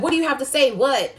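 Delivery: squaring up, demand she says it; confronting the bully. Retold or imitated. Standing up to the girl in class, a loud challenging shout.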